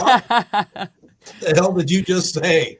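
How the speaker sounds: slow and laughing through the words; can barely get it out for laughing. Real, not acted. Host mocking the ad line while laughing.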